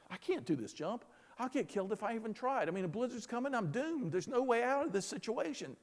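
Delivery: mildly panicked, defeated self-talk; negative self-talk. Acted. Stranded climber talking himself out of the jump, defeatist. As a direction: talk yourself out of it, pitch riding up a little. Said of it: "negative self-talk".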